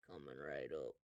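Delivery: very low, hushed barman; reply deep and quiet; waiter. Acted. Waiter pours two fire waters, deep and quiet. Game master voices the same waiter.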